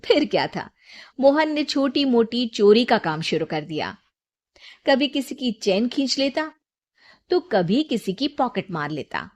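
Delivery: ordinary delivery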